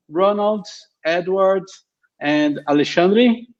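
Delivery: usual speaking voice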